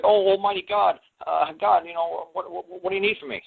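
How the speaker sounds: high-pitched, stammering awe before God; acted-out awestruck pleading; awed petitioner. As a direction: answer the Almighty in a high, halting voice. Acting awestruck reply to God.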